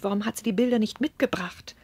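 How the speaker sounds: ordinary talk, nothing marked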